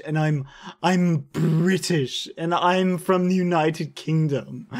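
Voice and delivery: mocking British accent; [name]'s mock British. Shown as a demonstration. [name] mocking British people, exaggerated British accent. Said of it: "that's [name]'s mocking British people voice".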